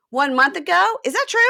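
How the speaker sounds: high, loud rising questions; acted disbelief. Acting boyfriend stunned by her revelation.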